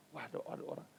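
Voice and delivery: hushed unvoiced whisper; hushed aside. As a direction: whisper it as if someone's at the door. Retelling alarm at visitor.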